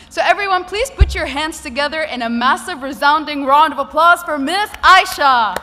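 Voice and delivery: loud, rising emcee build-up; hyped announcer. Real, not acted. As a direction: whip up the crowd for applause. Host introducing keynote to crowd.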